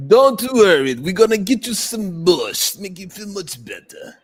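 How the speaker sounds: slow, exaggerated Russian accent. Shown as a demonstration. Joking with co-hosts in a put-on Russian accent. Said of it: "That's my, uh, Russian accent".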